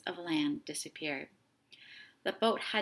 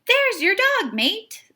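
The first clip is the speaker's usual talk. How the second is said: much higher, loud joyful call; excited shout; 13 semitones higher, 15 dB louder, slower than usual. A narrator's reading. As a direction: shout the good news. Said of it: "the fisherman said".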